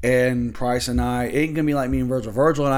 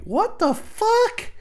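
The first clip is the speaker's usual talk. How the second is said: very high, slow, stretched what the fuck; shrieking disbelief; much slower than usual. Acted. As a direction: squeal it in total shock. Shocked outburst.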